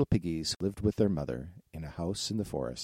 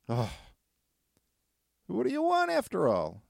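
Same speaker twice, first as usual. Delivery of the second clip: piggy voice; 12 semitones higher than usual. Acted. Piggy asks wolf.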